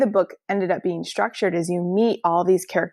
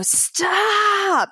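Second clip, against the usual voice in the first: sudden high shout, much higher; mom screaming stop; 13 semitones higher than usual. Retold or imitated. Mom yelling at her child running toward the street, high panicked shout. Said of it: "my mom started screaming".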